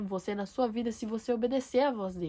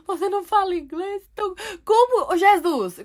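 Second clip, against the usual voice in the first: higher, louder dismayed disbelief; incredulous; 8 semitones higher, 7 dB louder than usual. Retold or imitated. Woman learns stranger speaks no English, incredulous. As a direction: act out a startled plea to Jesus.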